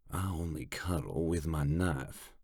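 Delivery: deadpan killer answering a joke; muttered evenly. A narrator's reading. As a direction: mutter it without looking up. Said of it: "Tongue mutters evenly".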